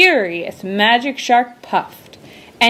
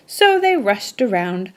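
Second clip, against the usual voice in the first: ordinary delivery, nothing marked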